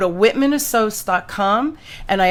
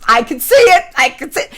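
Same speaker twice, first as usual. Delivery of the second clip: teasing husband; 6 semitones higher, 7 dB louder than usual. Retold or imitated.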